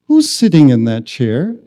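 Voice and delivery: loud, pointed retold question. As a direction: ask it seriously and firmly. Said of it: "he becomes a more serious".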